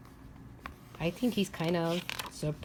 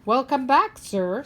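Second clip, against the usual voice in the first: doorman greeting; 5 semitones higher, 6 dB louder than usual. Acted. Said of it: "says the doorman".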